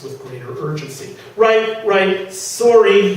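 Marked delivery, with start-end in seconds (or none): none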